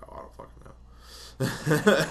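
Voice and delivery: high, slow, fed-up whine; exasperated drawl. Retold or imitated. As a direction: let it drag out, fed up. Desk lady's exasperated reply.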